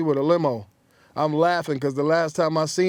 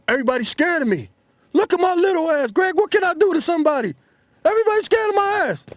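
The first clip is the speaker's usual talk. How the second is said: much higher, louder, fast; excited boasting; 13 semitones higher, 4 dB louder than usual. Retold or imitated. Friend showing off to his old buddy, high fast excited bragging. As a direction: crow about it like an overexcited kid.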